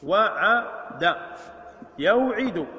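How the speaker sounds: وعد stretched very slowly, every sound held long; slowly drawn out. Shown as a demonstration. Teacher spelling out وعد for students, very slowly drawn out. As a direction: draw out وعد for the class. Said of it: "teacher drilling verb forms".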